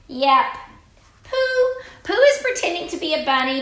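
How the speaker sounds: high squeak for the toy Pooh; squeaky Pooh voice. Pretend. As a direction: pipe up like a little toy bear. Puppet Pooh speaks up.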